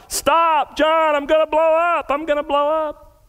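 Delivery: high, panicked shriek of terror; retold panicked scream. Preacher recreating a possessed woman's scream in church. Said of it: "and she screamed out".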